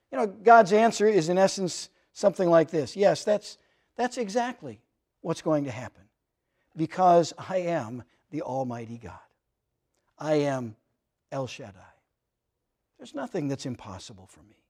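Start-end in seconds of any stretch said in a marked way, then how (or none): 2.9-14.6 hushed voice of God. Retold or imitated. God answering laughing Abraham, soft and low. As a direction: hush it down, awed certainty.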